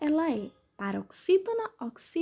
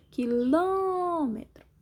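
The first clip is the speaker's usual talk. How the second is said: "Quilômetro" with LÔ stretched long and high; stress on LÔ; 4 semitones higher, much slower than usual. Shown as a demonstration. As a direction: draw out the LÔ in "Quilômetro". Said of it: "Sílaba mais forte é o ló".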